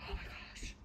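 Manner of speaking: barely audible whisper; hushed, voiceless horror; frightened whisper. Real, not acted. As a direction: whisper it in real dread. Terrified, watching the spider.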